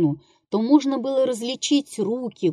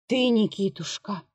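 soft, spent murmur of the name; exhausted mumble; 6 dB quieter than usual. A narrator's reading. Worn-out actor murmuring to the prompter, exhausted mumble. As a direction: mumble it with no strength left. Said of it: "бормотал обессилевший Колхаз".

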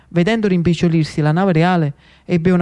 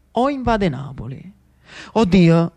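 ordinary talk, nothing marked

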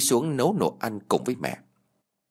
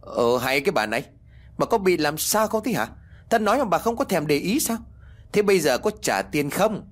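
angry yelling; 7 semitones higher than usual. A narrator's reading. Landlord startling the silent old woman, angry high-pitched yell. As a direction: raise the pitch and demand an answer. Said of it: "Lão gào lên làm cho bà lão giật mình".

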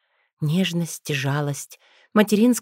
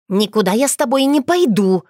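louder, higher defiant shout; loud protest; 5 semitones higher, 5 dB louder than usual. Acted. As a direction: refuse him at full volume. Witch refusing werewolf, quarrel.

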